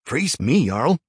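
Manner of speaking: excited shout over a crowd. A narrator's reading. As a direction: call out loud and high. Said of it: "Eric shouted over the cheers".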